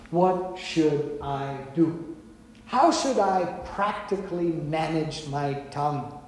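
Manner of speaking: slow, soft, low prayer; prayerful plea. Acted. Believer asking God for help, softly prayerful. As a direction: ask humbly, softly and slowly.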